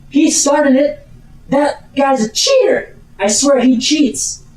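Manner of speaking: high, indignant accusation; Martha. Acted. Duellist blaming her rival to a colleague, high indignant complaint.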